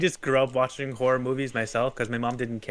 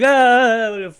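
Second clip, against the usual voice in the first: high, loud "ah" of retold fright; hammed-up frightened yell; mock scared scream; 11 semitones higher, 10 dB louder than usual. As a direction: yelp like a frightened kid, playfully. Retelling kids scared of toys.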